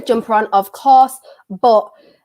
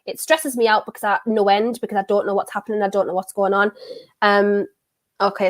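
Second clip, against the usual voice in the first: ordinary delivery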